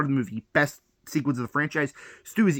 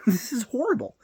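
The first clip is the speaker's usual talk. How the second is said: exasperated laugh; 9 semitones higher than usual. Real, not acted. Ranting about casting, laughing.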